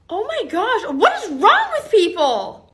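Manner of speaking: loud, drawn-out exasperation; appalled outburst; exasperated. Acted. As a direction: cry out in disbelief, high and slow. Teacher appalled by story.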